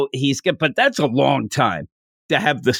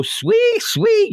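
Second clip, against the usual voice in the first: much higher, squealed like a pig; squealed trigger word. Acted. Squealing the candy's trigger word like a pig. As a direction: squeal the word sweet as a piglet would.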